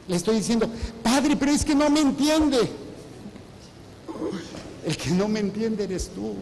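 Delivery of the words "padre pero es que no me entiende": queja de feligrés. Retold or imitated. Feligrés quejándose imitado.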